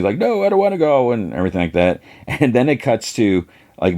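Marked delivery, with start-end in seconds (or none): 0.2-1.1 very high wailed protest; mock protesting. Souls protest being pulled out, high mock wail. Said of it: "The voices are protesting".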